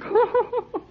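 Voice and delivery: high giggling laugh breaking out; can't hold back a teasing giggle; burst of laughter. Acted. Boy laughing at the blushing captain with a high teasing giggle.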